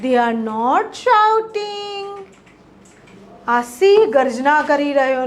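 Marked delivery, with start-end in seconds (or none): 0.1-2.1 very slow, clear dictation of each English word; teacher modelling English slowly; slow model sentences. Shown as a demonstration. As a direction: spell the sentence out slowly for learners. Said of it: "તેઓ બૂમો પાડી રહ્યા નથી".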